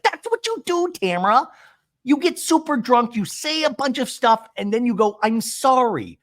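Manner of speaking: high-pitched mock tirade; imitated high, heated scolding; scolding accusation. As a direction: act out an exasperated telling-off. Retold scolding in quarrel.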